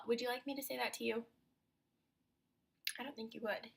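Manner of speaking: usual speaking voice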